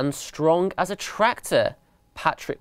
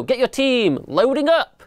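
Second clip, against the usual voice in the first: called orders; 9 semitones higher than usual. A narrator's reading. Boss mouse orders gang.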